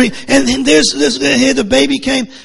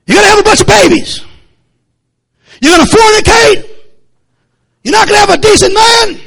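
higher, louder warning shout, fast at times; retold preaching shout; 7 semitones higher, 4 dB louder than usual. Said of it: "I preached to 'em".

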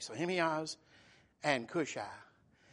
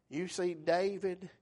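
ordinary talk

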